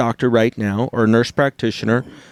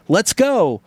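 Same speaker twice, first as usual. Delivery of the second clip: "Let's go" pitched higher and falling on "go"; an excited, higher "Let's go"; 8 semitones higher than usual. Shown as a demonstration. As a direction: lift "Let's go" up high, then let "go" fall. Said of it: "in four different ways".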